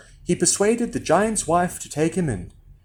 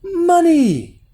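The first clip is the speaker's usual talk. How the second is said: high, gleeful cry; excited boy crowing; shouting; 6 dB louder than usual. A narrator's reading. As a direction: shout it in triumph, stretched out. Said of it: "he shouted".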